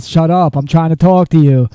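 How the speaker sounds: loud mock-impatient scolding; telling him off. Prank caller silencing the confused store owner, mock-irritated. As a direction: bark it like a rude prank caller. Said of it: "I was telling him to shut up".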